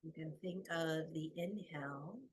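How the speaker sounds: soft, unhurried meditation voice with long pauses; guided meditation. Acted. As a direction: guide them gently into the breath.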